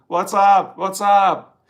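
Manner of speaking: American slang greeting. Retold or imitated. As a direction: toss it off like a cool American kid. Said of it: "I love the slang book".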